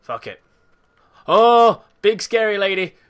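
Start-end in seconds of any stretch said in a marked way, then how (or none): 1.3-1.8 startled. Real, not acted. Horror game scare. 2.0-2.9 excited. Real, not acted. Taunting scary enemy.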